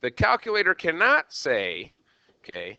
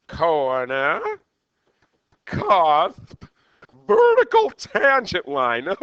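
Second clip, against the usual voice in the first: Mad Hatter impersonation; 3 semitones higher, 6 dB louder, much slower than usual. Acted. Teacher repeats the list to the class as the Mad Hatter. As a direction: ham up a manic Hatter, stretching each word. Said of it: "that was my Mad Hatter impersonation".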